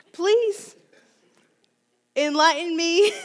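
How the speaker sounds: high, laughing plea to the crowd; laughing, half-pleading. Real, not acted.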